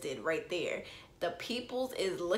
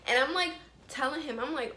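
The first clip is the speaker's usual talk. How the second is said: normal talking voice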